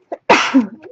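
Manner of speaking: sudden loud cough; a real sharp cough. Real, not acted. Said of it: "Excuse me".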